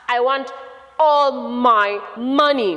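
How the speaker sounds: slow, loud, emphatic demand; demanding son, loud and drawn out. Acted. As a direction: hit each word hard and stretch it. Son demands money in story.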